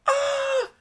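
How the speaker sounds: high, loud, slow unsure yell; hesitant shout. Retold or imitated. Van driver yelling an unsure answer. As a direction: shout it back, not quite sure. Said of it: "I'm screaming back and forth".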